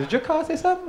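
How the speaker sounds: put-on high girlish voice, laughing; girl asking. Girl amazed her friend's car talks, high laughing voice. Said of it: "Girl says".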